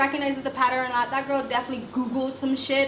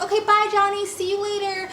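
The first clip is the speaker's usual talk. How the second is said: mock breezy goodbye; 8 semitones higher than usual. Telling the wife how she should have left him, mock cheerful farewell. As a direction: toss off a cheery bye as if nothing is wrong. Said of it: "you literally should be like".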